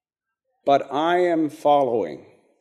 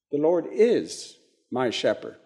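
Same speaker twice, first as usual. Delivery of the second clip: is raised, pause, softer ending; stress on is; 4 dB quieter than usual. Shown as a demonstration. Teacher restates the here and now, stress on is. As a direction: lift is, then let my shepherd drop. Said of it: "It's reality".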